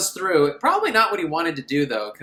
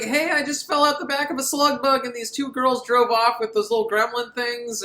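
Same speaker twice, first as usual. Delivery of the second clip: lightly voiced Jody; 8 semitones higher than usual. Jody quoted in recap.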